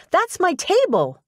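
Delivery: very high, protesting call; indignant outcry. A narrator's reading. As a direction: claim it in high-pitched disbelief. Protests to strangers taking table.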